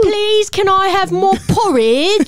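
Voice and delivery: high, slow, begging mock English orphan; Cockney accent. Host playing hungry orphan, begging English accent. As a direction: plead in hammed-up British. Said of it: "You just go Cockney".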